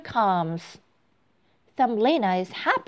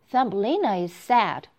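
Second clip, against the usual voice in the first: ordinary delivery, nothing marked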